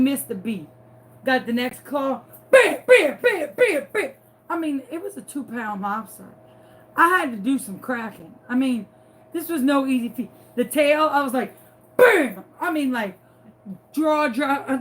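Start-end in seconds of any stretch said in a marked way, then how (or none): 2.5-4.1 loud, high bursts of bam; rapid imitated smashing noises; cracking bams. Acts out cracking the lobster. 12.0-12.4 loud, drawn-out bam; imitated smash; cracking bam. Acts out cracking the lobster.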